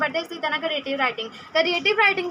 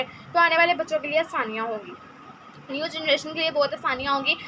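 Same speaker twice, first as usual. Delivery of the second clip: ordinary delivery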